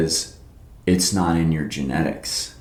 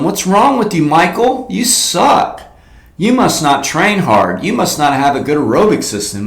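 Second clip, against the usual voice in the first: higher-pitched heckling of a rival; mock taunt; 8 semitones higher, 4 dB louder than usual. Runner lapping Michael Phelps, mock taunting. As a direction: jeer at him as you pass, pitched up.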